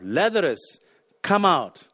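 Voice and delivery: ringing loud summons; loud call. Shown as a demonstration. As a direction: call out Lazarus, come out loudly. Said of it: "Jesus called in a loud voice".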